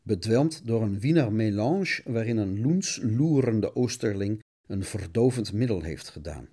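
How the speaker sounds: dramatic storyteller voice, lightly heightened; lightly dramatised cartoon-serial narrator; radio play narration. Narrates radio play over music.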